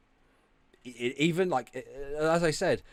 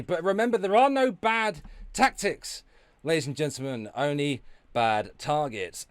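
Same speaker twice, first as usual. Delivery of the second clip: mock slogan; 7 semitones higher, 6 dB louder than usual.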